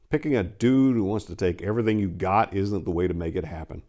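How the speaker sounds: ordinary delivery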